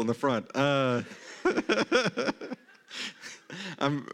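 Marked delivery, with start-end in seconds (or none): 1.4-2.5 high-pitched real giggling laugh. Real, not acted. Pastor cracking up at his own joke, laughing. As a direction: burst into helpless chuckles.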